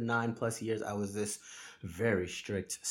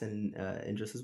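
ordinary talk, nothing marked

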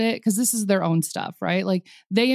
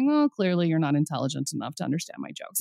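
mock dismissal; 5 semitones lower, 5 dB quieter than usual. Acted dismissal of humourless people.

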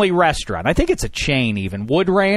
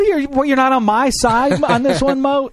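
high, wounded disbelief; mock hurt surprise; 10 semitones higher than usual. To his mother, mock wounded pitch-high disbelief. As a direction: act betrayed, pitch way up. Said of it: "I'm like".

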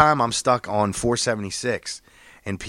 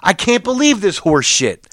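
exasperated; 7 semitones higher, 4 dB louder than usual. Retold or imitated. Venting to driver behind.

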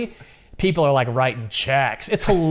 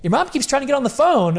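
dad voice, high; 9 semitones higher, faster than usual. Retold or imitated. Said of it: "And he goes".

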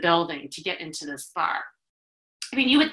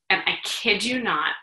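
ordinary delivery

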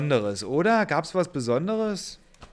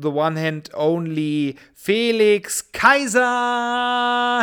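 brash fight promoter hype; 2 semitones higher, 4 dB louder, slower than usual. Acted. Announcing co-host like a fighter.

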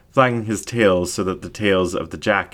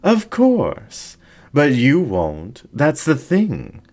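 higher, slow, silky menacing fox voice; seductive incubus ringmaster; 8 semitones higher, 3 dB louder, much slower than usual. Acted. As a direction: purr the threat slowly from above. Ringmaster answers Jack.

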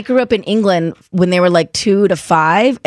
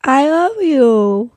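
put-on slow, higher toddler "I love you"; 5 semitones higher, much slower than usual. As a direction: imitate a toddler's first "I love you", drawn out. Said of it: "she says it like that for the first time".

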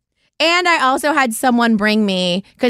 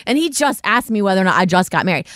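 ordinary talk

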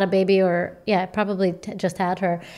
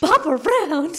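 much higher, teasing sing-song; playful high parody of the plan; mock sing-song echo; 9 semitones higher, slower than usual. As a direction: toss it off in a silly high voice. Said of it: "And you were like".

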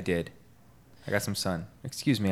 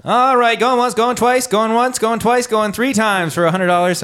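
loud, fast, high auctioneer chant; 13 semitones higher, 7 dB louder than usual. Pretend. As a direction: call it like an auctioneer. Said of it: "I sounded like a car salesman, like an auctioneer".

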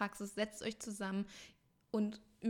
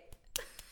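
breathy laugh; 10 dB quieter than usual. Real, not acted. Laugh after cheering.

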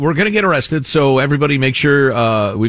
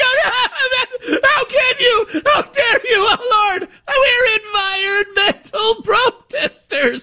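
high wailing, sobbing mockery; mock crying protester. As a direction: wail it like a hysterical crybaby. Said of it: "they're like".

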